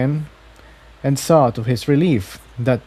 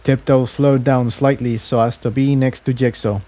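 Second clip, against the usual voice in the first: ordinary delivery, nothing marked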